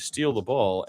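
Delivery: ordinary talk, nothing marked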